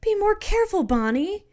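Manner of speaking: high, loud scolding cry; sharp high-pitched reprimand; acted yell. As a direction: yell out a worried warning. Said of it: "you can't help but yell".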